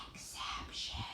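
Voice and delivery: hushed, unvoiced whisper; playful whisper. Shown as a demonstration. Teacher naming the spelling rule to pupils, whispered. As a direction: whisper it like a secret keyword.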